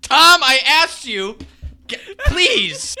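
very high, drawn-out begging; comically desperate, slow appeal; exasperated plea. Real, not acted. Begging cohost after absurd tangent.

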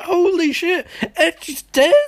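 high-pitched retold astonishment; stunned and high, playing it up; mock shock. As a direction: react in exaggerated disbelief. Retells own shocked reaction.

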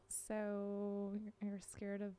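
hushed, puzzled whisper; barely voiced, asking in disbelief; whispered question. Real, not acted.

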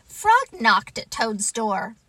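ordinary delivery

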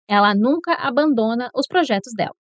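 ordinary talk, nothing marked

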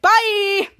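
loud, high, drawn-out goodbye; breezy farewell. Acted. Jake abandoning his son as he leaves, breezy farewell. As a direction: call it out as you make your exit. Parent hurries off, leaving son.

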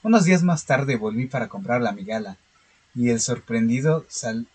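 calm, steady horror story reading; story narration. Acted. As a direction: read it like a measured horror tale. (lectura de cuento de terror)

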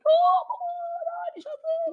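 excited shout. Real, not acted. Gamer shrieking at his friend in a clutch moment. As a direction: scream it as you land the hit.

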